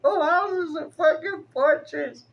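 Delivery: high, laughing squeal; words squeezed out through real laughter; laughing hard. Real, not acted. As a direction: say it cracking up. Laughs at own fortress.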